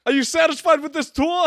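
high, silly New York tour guide; mock New York Dickens. Friend plays Dickens guiding a London tour, mock New York accent. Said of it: "That's how Charles Dickens talks?".

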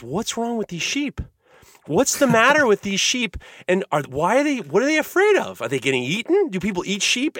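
high-pitched, bewildered questioning; puzzled young boy. Retold or imitated. Ten-year-old puzzles over nervous sheep, high and bewildered. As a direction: fret over it like a confused child. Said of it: "all I could think of is".

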